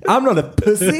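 high, loud boast through laughter; mock macho boast. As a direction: crack up while bragging. Joking macho boast amid laughter. (skämtsamt skryt under skratt)

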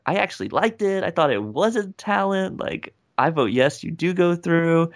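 high-pitched imitation of an approving judge; Heidi Klum. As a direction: lift the voice high for her verdict. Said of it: "Heidi Klum's like".

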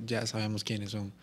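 retold quote. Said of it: "estaban con acento colombiano".

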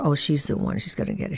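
a private whisper to herself, envious and sure; whispering her inner thought to herself. Retold or imitated. Remembering a rival actress, whispering her thought. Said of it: "And I thought".

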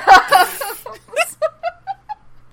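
loud, high burst of laughter. Real, not acted. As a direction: crack up helplessly. Host cracks up mid-recap.

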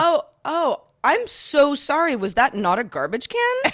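a sugary, put-on sorry; mock innocent. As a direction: play dumb sweetly to needle her. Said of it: "I felt like being a dick".

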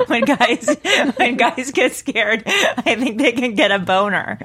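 talking through real giggles; words broken up by laughter; giggling explanation. Real, not acted. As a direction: try to explain while cracking up. Giggling while explaining fear boner.

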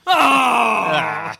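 loud dismayed shout; outraged cry. Acted. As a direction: yelp of disbelief. Outraged cry at smoking ban.

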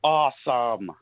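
lightly acted yell of delight; imitated kid's yell. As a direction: mimic an excited kid calling out, held back. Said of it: "he would just yell".

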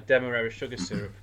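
ordinary delivery

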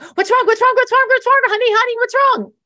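high, loud, frantic repeated questions; hammed-up shrieky alarm; imitated panic. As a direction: mimic someone panicking, voice shot way up. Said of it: "but the panic in Abby's voice".

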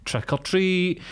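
high-pitched trick-or-treat call; mock doorstep call. Pretends to call at driver's door.

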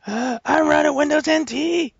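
high, hammed-up defiant holdout; defiant old-software user. Co-host mocking someone clinging to ancient software, defiant high voice. Same mock user character continues.

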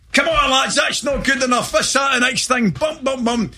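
very high-pitched, hammed-up dressing-room rallying; players arguing at half-time. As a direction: overact a footballer geeing up the lads. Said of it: "The boys are all arguing".

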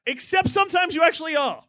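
high shouted punchline; hammed-up shouted reveal; mock shout. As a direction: yell the twist for laughs.

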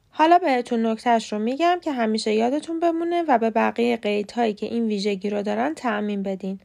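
ordinary talk

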